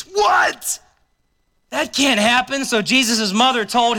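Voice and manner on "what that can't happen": sudden high, far-off shout of disbelief; a mock gasp of horror; shocked what. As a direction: yell it like it can't be true. Preacher reacts to wine running out.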